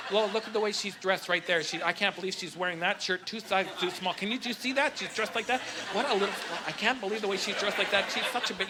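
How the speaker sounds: hushed catty gossip; mock gossiping women. Comic imitating women judging another's clothes, hushed gossip. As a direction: mutter it low as a scandalized gossip. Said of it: "women are like".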